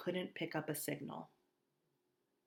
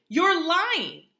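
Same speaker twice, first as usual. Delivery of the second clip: loud high scream; 12 semitones higher, 14 dB louder than usual. Acted. Augie screams at Via, getting up. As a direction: scream it at your sister. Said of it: "I screamed at Via".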